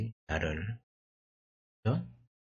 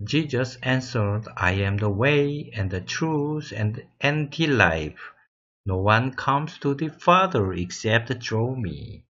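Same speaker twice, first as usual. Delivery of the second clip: slow, careful English recitation; measured classroom reading; much slower than usual. Shown as a demonstration. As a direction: read the whole verse slowly for the class. Teacher reads full verse slowly.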